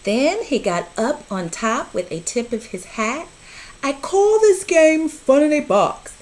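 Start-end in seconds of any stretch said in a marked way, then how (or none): 3.8-6.1 higher, louder announcer cat voice; the cat grandly naming his game; showman cat. A narrator's reading. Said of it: "said the cat".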